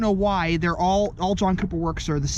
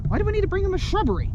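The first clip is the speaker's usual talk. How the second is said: baffled quote; 10 semitones higher, much faster than usual. Retold or imitated. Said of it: "I'm like".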